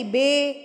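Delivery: بے as a plain "bay"; no "ee" glide on بے; mistaken "ay" sound. Shown as a demonstration. Showing students what to avoid, بے as "bay". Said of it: "اے کی sound نہیں آئے گی".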